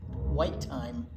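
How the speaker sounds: lively picture-book read-aloud for little ones; reading to children. Acted. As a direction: tell it like a storybook to a circle of kids.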